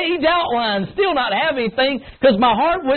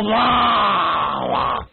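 grizzly bear whine; 5 semitones lower than usual. Retold or imitated. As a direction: groan it like a bear. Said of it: "They sound like a grizzly bear".

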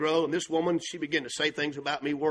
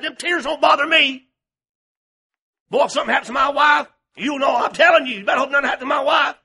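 loud, much higher, fast shouting; furious shout; 10 semitones higher, 11 dB louder than usual. Retold or imitated. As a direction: yell the threat at her with a laugh of rage. Threatens woman who hurt his wife.

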